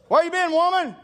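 high, loud, furious bellow; angry yell. Retold or imitated. Husband bellowing at his wife on her return.

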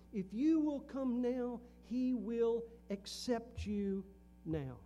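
slow, loud pulpit plea; preaching fervor. Acted. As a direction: lean in and plead it slowly. Preaching the invitation.

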